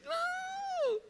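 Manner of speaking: very high, drawn-out falsetto wail; mock desperate cry. Preacher replaying the boy's protest at the TV, mock desperate cry. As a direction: stretch it into a squealed mock protest.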